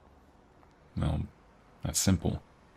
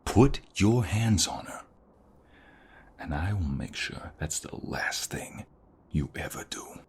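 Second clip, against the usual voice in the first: menacing threat; 4 semitones higher, slower than usual. Acted. Boyfriend threatening the robber who eyes his partner's necklace, menacingly.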